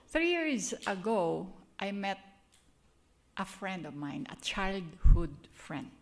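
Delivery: ordinary delivery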